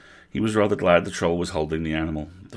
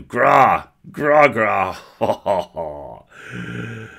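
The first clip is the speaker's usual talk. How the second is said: loud, higher beaming troll laughter; a delighted troll grunting and laughing; happy troll; 5 semitones higher, 8 dB louder, much slower than usual. Acted. Troll beaming at the elf, loud happy grunts. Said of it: "The troll beamed at him".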